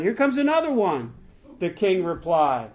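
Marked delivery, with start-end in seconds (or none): 0.0-1.1 high-pitched call, little added volume. A narrator's reading. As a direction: announce it from above in a raised pitch. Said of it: "He shouted down".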